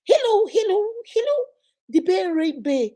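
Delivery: high, put-on fake American twang; mock someone faking an American accent. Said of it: "they now speak like the Americans".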